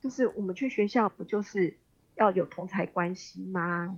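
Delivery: ordinary delivery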